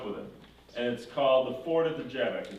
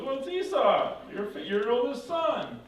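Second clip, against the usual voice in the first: high, insistent protest; baffled son; 11 semitones higher than usual. Retold or imitated. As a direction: act the real son, puzzled and emphatic. Real Esau answers, baffled.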